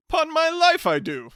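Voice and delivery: loud, high hearty greeting; top of his voice. Acted. Hearty cousin greeting the bachelor at the top of his voice. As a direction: boom it out like a jovial bore. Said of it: "spoke at the top of his voice".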